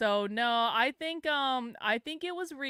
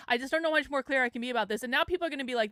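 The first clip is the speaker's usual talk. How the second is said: usual speaking voice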